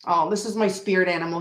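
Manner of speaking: usual speaking voice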